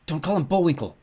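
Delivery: high, urgent scolding; hissed rebuke. A narrator's reading. Carter scolds his sister, hissing. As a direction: hiss a mild warning at your sister. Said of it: "Carter hissed".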